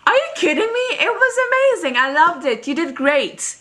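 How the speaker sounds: high, indignant retold outburst; mock offended. Fan to idol who called the show boring, mock offended. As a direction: start very high and loud, stay indignant. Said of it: "I got really offended and mad".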